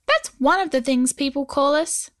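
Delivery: high little goblin voice; tiny homunculus voice. Acted. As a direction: speak as a tiny stone creature. Goblin Scrat speaks.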